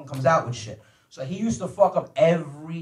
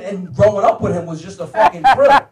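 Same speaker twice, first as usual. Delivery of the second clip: ordinary delivery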